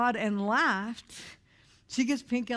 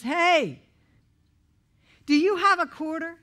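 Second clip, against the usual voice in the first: put-on high, loud kid's plea; little boy voice; 7 semitones higher, 7 dB louder than usual. Little boy begging a stranger for a quarter, high loud kid voice. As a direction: pipe up like a small boy asking a stranger.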